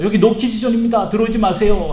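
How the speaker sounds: high, loud acted warning; officious refusal. Disciples turning children away, acted. (높고 큰 소리로 출입을 막듯)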